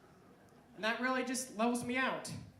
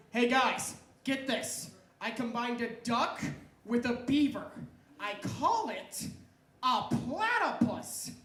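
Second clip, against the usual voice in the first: proud inventor God; 5 dB louder, slower than usual. Acted. Plays God unveiling his invention.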